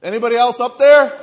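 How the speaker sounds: high, loud comic shout; calling for help. Acted. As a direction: holler up for someone else. Hanging man calls past God.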